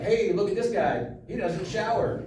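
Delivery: light mock heckler; heckler taunt. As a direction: sneer like a heckler. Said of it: "The first direction is like".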